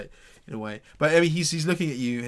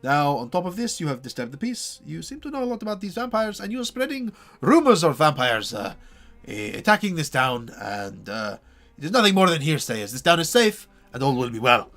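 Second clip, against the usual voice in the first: pompous burgomaster; 7 semitones higher, 7 dB louder than usual. Acted. Burgomaster pronounces judgment.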